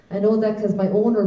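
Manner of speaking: usual speaking voice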